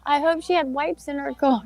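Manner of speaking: appalled. Retold or imitated. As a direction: fret about her dirty hands. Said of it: "I was like".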